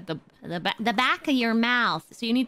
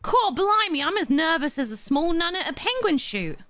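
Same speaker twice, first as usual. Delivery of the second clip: cheeky Cockney voice, raised pitch; Cockney accent; 7 semitones higher than usual. Shown as a demonstration. British friend demos a Cockney accent for Gigi. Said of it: "I wanna learn a Cockney accent!".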